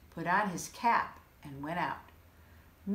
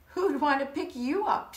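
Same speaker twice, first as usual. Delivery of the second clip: higher, louder mocking jab; teasing; 7 semitones higher, 6 dB louder than usual. Sheep teasing Porcupine, mocking and loud.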